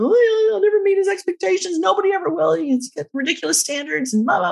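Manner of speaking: sarcastic sobbing rant at herself; mock yelling at herself. Alone in her car after the fight, high sarcastic mock rant. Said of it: "And I'm yelling at myself".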